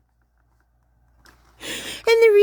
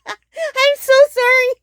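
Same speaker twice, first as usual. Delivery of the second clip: high, slow, louder words squeezed through laughter; laughing apology; 9 semitones higher, 4 dB louder, slower than usual. Real, not acted. Laughing at the escaping frogs, sorry through giggles. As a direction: apologise while cracking up.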